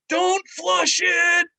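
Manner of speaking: high, slow drawn-out shout from far off; joke punchline yell. Retold or imitated. Guest stuck in a giant pool shouts down the hall, high and slow.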